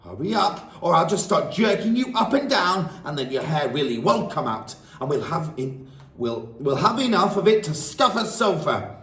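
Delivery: high, loud threatening command; bully him into obeying; furious demand. Acted. Headmistress threatening the dangling boy, high and loud.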